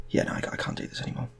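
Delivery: fast, soft whispered brush-off. Acted. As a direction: rush it out under your breath. Wife ends it on phone.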